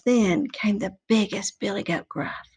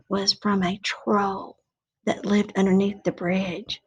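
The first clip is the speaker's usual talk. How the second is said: whispering; 3 semitones lower, 3 dB quieter than usual. Acted. Narrating troll reveal.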